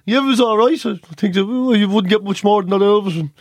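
mock-cheery wrap-up, pretending it went fine; fake polite reassurance. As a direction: cover the awkwardness with forced politeness. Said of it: "we were both doing the whole thing".

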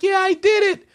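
very high-pitched squeal of victory; hammed-up falsetto glee; mock triumph. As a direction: imitate an overjoyed kid celebrating. Voicing player catching the ball.

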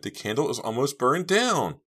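high, loud, alarmed; desperate cry. A narrator's reading. Trapped girl warning about the candle, crying out. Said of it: "Jade cried".

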